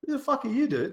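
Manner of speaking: high-pitched mock indignation; indignant. Gamer retelling his comeback to guild leader, mock indignant. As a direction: retell an affronted comeback, voice shooting up.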